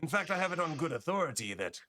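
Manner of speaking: high, swooping merchant patter; put-on accent that wobbles as he explains; slipping merchant accent. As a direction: start high on a hurried denial, then pitch the job. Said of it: "I'm losing the accent".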